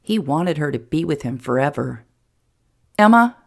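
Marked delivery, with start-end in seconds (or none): 3.0-3.4 sudden loud, higher-pitched proposal; blurted out. A narrator's reading. Jason to Emma, proposing on impulse, blurted out. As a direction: burst out with the question. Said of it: "Jason blurted out".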